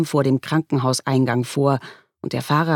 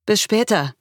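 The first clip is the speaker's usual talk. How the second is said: light, higher-pitched casual goodbye; 7 semitones higher than usual. A narrator's reading. Child saying goodbye to parent, casual. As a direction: toss it off easily. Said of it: "sagte Laurie lässig".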